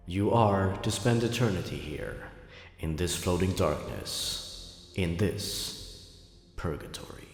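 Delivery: slow, low, distant doom-laden voice fading to a breathless hush; eerie voice of judgment. Acted. Unseen presence dooming the narrator to purgatory, slow and fading. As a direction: pronounce eternal sentence like an unseen presence.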